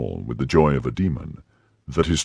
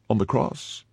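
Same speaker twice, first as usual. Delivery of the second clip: high, rising, incredulous question; crying out; 6 semitones higher than usual. A narrator's reading. Prisoner echoes her captor's demand, crying out in disbelief. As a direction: throw the words back, aghast. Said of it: "cried I, rising".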